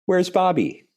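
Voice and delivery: mildly panicked parents searching for their son; frantic calling. Retold or imitated. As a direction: call out for the missing kid in a panic. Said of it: "they said".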